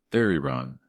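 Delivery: ordinary delivery, nothing marked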